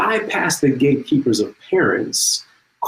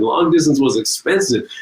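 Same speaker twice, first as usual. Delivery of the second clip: ordinary talk, nothing marked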